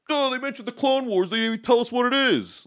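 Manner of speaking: high, whiny nitpicker voice; mock whiny fan. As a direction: whine like a fussy fan complaining.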